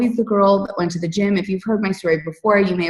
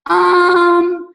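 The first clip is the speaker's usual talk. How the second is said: unsure hedge; 9 semitones higher, 4 dB louder, much slower than usual. Retold or imitated. Bluffing when asked about Insanity.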